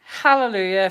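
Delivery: high, loud joyful cheer; triumphant shout. Real, not acted. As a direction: celebrate the win out loud. Winning the first number.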